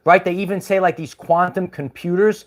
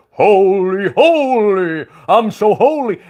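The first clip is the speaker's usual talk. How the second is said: mock holy voice; 2 semitones higher, 3 dB louder, slower than usual. Mocking people who pretend to be holy, sneering. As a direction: mimic a self-righteous holy type. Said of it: "they pretend to be all holy".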